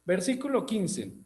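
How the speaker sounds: ordinary talk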